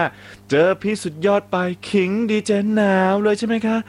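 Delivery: teasing read. Retold or imitated. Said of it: "บอกว่า".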